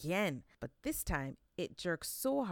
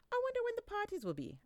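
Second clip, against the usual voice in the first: much higher, quick musing to himself; sleepy wondering Anansi; faster than usual. Acted. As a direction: Anansi just woken, wondering aloud. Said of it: "Anansi said to himself".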